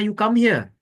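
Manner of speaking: loud, high summons; commanding call. Retold or imitated. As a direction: call her over with authority. Drunk Balaram commands Yamuna.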